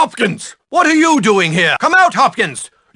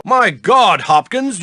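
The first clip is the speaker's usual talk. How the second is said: normal talking voice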